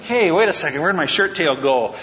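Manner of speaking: puzzled king caught half-dressed, lightly acted; flustered Saul. Saul fumbling with his shirt outside the cave, mock flustered. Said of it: "He's probably trying to tuck his shirt in".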